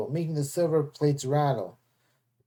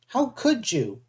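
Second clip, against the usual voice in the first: higher, hurt and angry; 7 semitones higher, slower than usual. A narrator's reading. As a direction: hurl it at the traitor. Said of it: "Annabeth sounded so angry".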